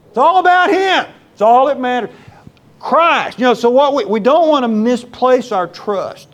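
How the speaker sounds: loud and high, full-force proclamation; fervent preaching shout. Real, not acted. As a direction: cry it out to the whole church with fervor. Sermon climax, shouted proclamation.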